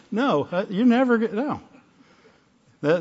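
normal talking voice

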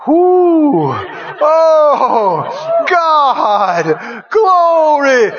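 long, drawn-out cries of wonder; awed exclamations. Acted. Preacher acting out believers seeing glory, in drawn-out awe. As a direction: marvel aloud, each word stretched out. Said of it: "you and I will be in glory with God going".

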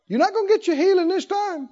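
the devil taunting a sick man; mocking devil voice. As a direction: sneer it like a tempter. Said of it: "Thoughts came".

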